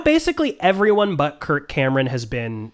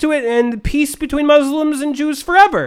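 excited; 9 semitones higher than usual. Retold or imitated. Continuing the scientist quote.

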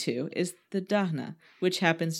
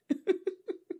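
high giggle; 13 semitones higher, 3 dB quieter than usual. Real, not acted. Laughing at own delivery.

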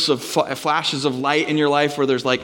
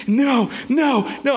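mock despair; 11 semitones higher, slower than usual. Finding flipped step.

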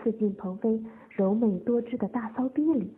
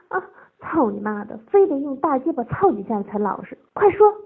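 loud bullying, climbing higher; angry order; 4 semitones higher, 11 dB louder than usual. A narrator's reading. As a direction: build to a barked command.

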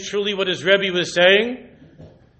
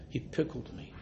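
breathy whisper of a revelation; an old man whispering what changed him; whispered secret; 5 semitones lower, 19 dB quieter than usual. Retold or imitated. Old man's climactic secret.

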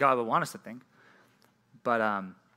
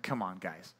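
disappointed mutter; 8 semitones lower, 11 dB quieter, slower than usual. Retold or imitated. Disappointed by tiny dressing packet.

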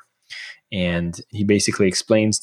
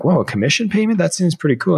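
pitched way up, a lightly mocked eager rookie; excited thought; 9 semitones higher than usual. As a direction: sell the young guy's sudden thrill at easy money. Excited about commission pay.

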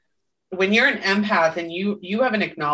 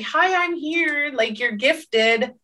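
higher, slow and sweet; gentle, loving; 6 semitones higher, slower than usual. Retold or imitated. Gifts gently announcing themselves, slow tender voice. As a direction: coax tenderly and slowly. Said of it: "very gentle, very loving".

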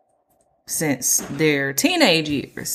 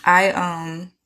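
ice in mouth. Real, not acted. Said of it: "y'all hear me chew the ice?".

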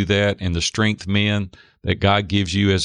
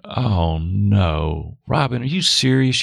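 mock dread; slower than usual. Retells dread at the second question.

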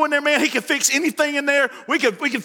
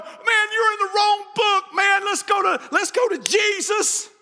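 high, urgent preacher's plea; exasperated retort; 7 semitones higher, slower than usual. Acted. Preacher answering a quibbler about Cain's wife, exasperated. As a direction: cut off the quibbling with raised pitch.

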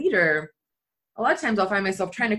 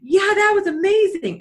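much higher and louder, overplayed cheer; forced enthusiasm; 14 semitones higher, 7 dB louder than usual. Answering the video guy with loud, high forced enthusiasm. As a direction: sell a fake happy answer.

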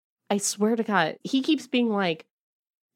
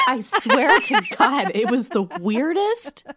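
ordinary talk, nothing marked

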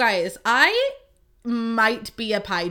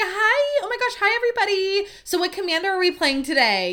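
much higher, louder, gushing hello to the table; annoying eager greeting; 10 semitones higher, 3 dB louder than usual. Retold or imitated. Greeting the magic night table, over-eager and gushing. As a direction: burst in as the overeager newcomer. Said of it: "who's annoying".

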